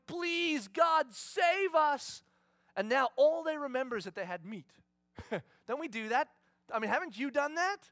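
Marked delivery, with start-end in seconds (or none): 0.1-2.2 pleading cry. Retold or imitated. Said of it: "crying out to God, going".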